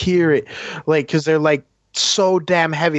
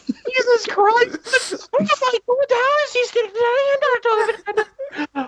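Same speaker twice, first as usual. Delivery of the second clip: high, slow, laughing wail; slower than usual. Real, not acted. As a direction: gasp it through tears of laughter. Laughing at dad story.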